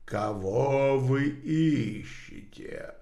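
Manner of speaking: slow, low otherworldly question; muffled spirit voice. Acted. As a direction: ask it slowly like a spirit. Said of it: "глухой голос".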